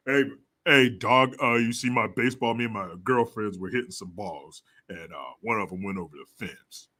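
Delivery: low dopey dude voice; deep dude voice. Retold or imitated. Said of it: "that's not how dudes sound".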